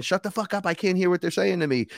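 high-pitched mock scream, no added volume; retold screaming. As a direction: imitate a frantic demand for silence. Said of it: "the other guy was screaming".

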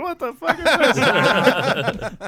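slow, high baffled outburst through a laugh; mock shocked laugh. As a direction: stretch it out, laughing in disbelief. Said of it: "I'm like".

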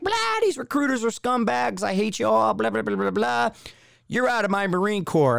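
high, strained imitation of a rant; mock angry tirade. Drill instructors berating recruit about scumbag recruiters, mock angry tirade. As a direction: rattle off a ranting drill instructor impression.

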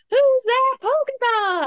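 high, drawn-out announcer call; TV show catchphrase. Retold or imitated. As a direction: belt out the show's end-of-segment question, stretched long. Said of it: "the little thing at the end".

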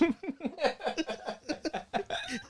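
high, soft breathy laugh; soft high laugh at his own joke. Real, not acted.

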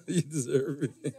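low, soft laugh through the words. Real, not acted. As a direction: chuckle while playfully teasing. Teasing Becky, laughing as he speaks.

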